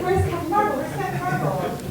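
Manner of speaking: high-pitched mock child asking over and over; pestering child. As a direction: imitate a kid pestering from the back seat. Imitates child nagging from the car.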